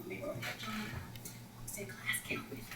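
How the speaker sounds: soft whispered prompt; feed him his lines in a whisper; whispered coaching. Retold or imitated. Coach whispers lines to the student leader, soft. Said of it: "He said".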